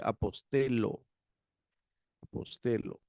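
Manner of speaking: "apostelo" with one plain L; single L. Shown as a demonstration. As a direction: say "apostelo" with a simple L, never a Spanish "ll". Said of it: "lo vamos a leer como una sola L".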